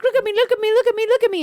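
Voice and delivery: high, loud attention-seeking chant; playful pestering. Retold or imitated. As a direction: pester like an excited kid wanting attention. Imitates husband pestering her for attention.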